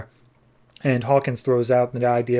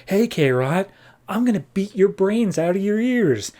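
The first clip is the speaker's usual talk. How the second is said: high-pitched retold threat; mock threat; 7 semitones higher than usual. Jediah jumping onto K-Rot, mock threat. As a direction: play-act a worked-up challenge without raising the volume.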